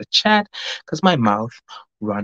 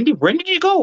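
surprised squeak. Real, not acted. Surprised at a chat message.

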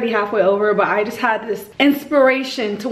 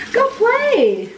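high coaxing; 10 semitones higher than usual. Real, not acted. Shooing toddler away.

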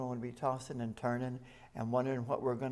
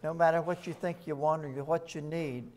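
ordinary talk, nothing marked

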